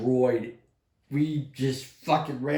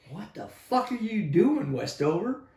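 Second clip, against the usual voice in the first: higher-pitched baffled question; retold incredulous challenge; 6 semitones higher than usual. Confronting Westover about the commotion, incredulous retold question. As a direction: confront a colleague in mock disbelief.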